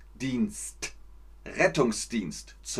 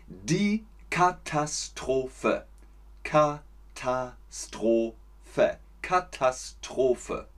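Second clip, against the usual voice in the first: slow teaching repetition; slower than usual. Shown as a demonstration.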